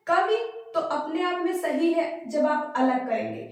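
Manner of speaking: ordinary talk, nothing marked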